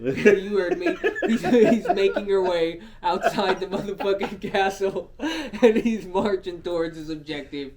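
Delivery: loud, high narration through laughter; real laughing. Real, not acted. Storyteller insisting he's making her way, laughing. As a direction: push on with the story while cracking up.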